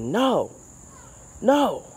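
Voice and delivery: retold scream. Gardener recounting yelling at a deer, retold scream. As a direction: shout it like scolding an animal off. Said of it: "I started screaming at him".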